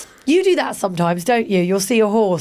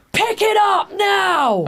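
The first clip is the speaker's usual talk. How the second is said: high, drawn-out angry shout; 11 semitones higher, much slower than usual. Acted. Posh stranger ordering dog walkers to clean up, shouting. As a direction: holler the order from far away. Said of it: "only to hear shouts of".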